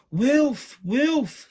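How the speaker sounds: high, very loud scream; girl faking an alarm, screaming to the town. A narrator's reading. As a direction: shriek it at the top of her voice. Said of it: "she screamed on the top of her voice".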